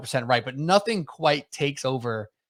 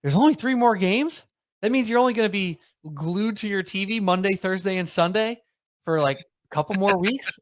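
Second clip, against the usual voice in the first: put-on incredulous girlfriend; 7 semitones higher than usual. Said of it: "my girlfriend's like".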